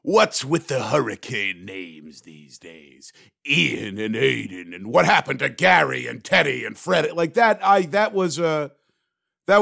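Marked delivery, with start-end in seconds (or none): 0.0-7.1 grouse through a stand-up bit, stretching out the words; comedian's exaggerated rant voice; George Carlin. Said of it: "where George Carlin could".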